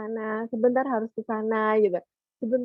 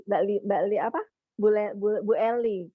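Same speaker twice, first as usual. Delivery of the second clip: ordinary delivery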